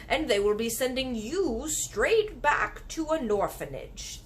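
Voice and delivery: kindly giant, drawn out. Acted. Giant warning the girl, slow. BFG voiced in story.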